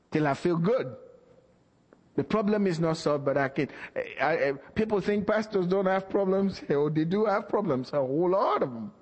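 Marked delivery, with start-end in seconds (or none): none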